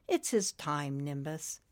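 soft, low and tender; calm comforting angel; gentle angel voice. Acted.

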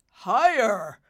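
emphatic prompt. Acted. Father prodding Nimbus to guess, loud and firm.

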